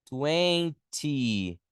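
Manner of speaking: "twenty" said slowly, clear final T; twenty pronounced. Shown as a demonstration. Teacher models "twenty" for the class, slow and clear. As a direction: model "twenty" for students, T sounded. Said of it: "a gente fala".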